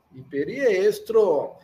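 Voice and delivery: normal talking voice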